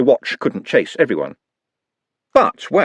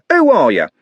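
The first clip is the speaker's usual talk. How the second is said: loud high shout; furious yell; 8 semitones higher, 6 dB louder than usual. A narrator's reading. Trev rounding on the others, shouting. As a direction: jab the question out in rage. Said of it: "screamed Trev".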